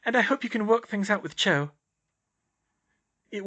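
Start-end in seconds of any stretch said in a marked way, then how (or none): 0.0-1.7 put-on lightly raised girl voice; young woman's wry thought; Hermione voice.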